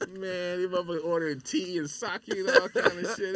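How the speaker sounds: normal talking voice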